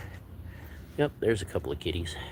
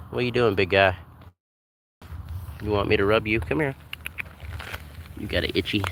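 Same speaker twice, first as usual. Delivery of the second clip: pet talk; 5 semitones higher than usual. Real, not acted. Talking sweetly to the cat.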